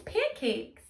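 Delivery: ordinary delivery, nothing marked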